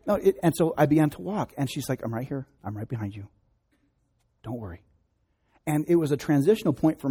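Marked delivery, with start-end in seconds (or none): 2.0-4.8 softer, tender mother's voice; soft reassuring mom. Retold or imitated. Mother walks behind her scared boy, softly reassuring. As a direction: hush the child's fear gently.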